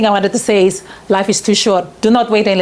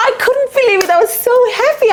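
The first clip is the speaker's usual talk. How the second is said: much higher, thrilled recollection; overjoyed; much faster than usual. Retold or imitated. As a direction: relive the joy of getting in. Recalls being accepted.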